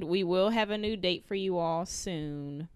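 ordinary delivery

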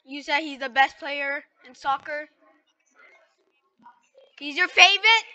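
usual speaking voice

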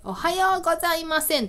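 playful mock-polite hello; joking greeting. Shown as a demonstration. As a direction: tease with a botched greeting. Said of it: "なんか私は茶化して言う".

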